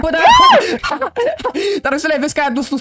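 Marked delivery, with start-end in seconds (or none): 0.3-1.8 shrieking laugh as the bees come; startled laugh while fleeing the bees; high squealing laugh. Acted. Bees chase the brother.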